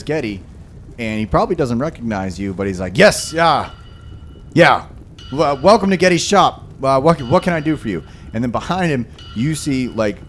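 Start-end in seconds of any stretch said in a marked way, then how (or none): 3.0-8.0 higher, hurried, strung-out smith; an exhausted shopkeeper rushing; frazzled shopkeeper. Acted. GM voices frazzled Getty.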